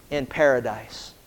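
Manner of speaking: low, soft, slow; tender promise. Acted. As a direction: let it land gently. Quoting Jesus's promise.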